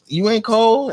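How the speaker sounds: high, loud mock girl voice; teasing girl. Girlfriend on couch coaxing him closer, high playful. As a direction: pitch it way up, coaxing. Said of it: "she said".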